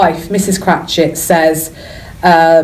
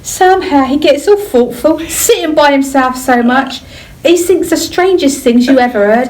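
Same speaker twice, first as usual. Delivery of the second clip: put-on reading Bob in a Cockney accent; Cockney accent, lightly done; 3 semitones higher than usual. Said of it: "really good Cockney accent on".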